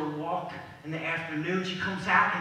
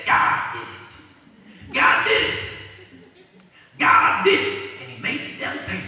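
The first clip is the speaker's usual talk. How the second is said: hollering praise; 2 semitones higher, 9 dB louder than usual. Acted. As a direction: cry it out in triumph, drawing each word. Woman's triumphant final praise.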